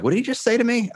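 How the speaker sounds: incredulous. Retold or imitated. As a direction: replay the moment you couldn't believe it. Retelling shock at cellmate's words.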